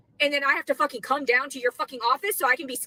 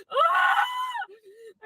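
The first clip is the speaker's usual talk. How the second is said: high, sobbing wordless scream of despair; anguished scream. Real, not acted. At the end of her rope, a real scream. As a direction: let out one raw cry of anguish. Breaks down yelling at authorities.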